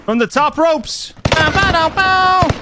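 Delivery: high, slow hype yell; hyped wrestling yell. Real, not acted.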